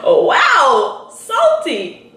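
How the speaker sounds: high, teasing jab; mocking reaction. Reacting to his silent snub, high mocking tone.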